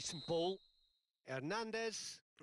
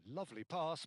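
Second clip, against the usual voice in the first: ordinary delivery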